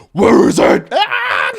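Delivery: high, very loud screaming demand; furious yell. Retold or imitated. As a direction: bellow at the child. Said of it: "we're over here like".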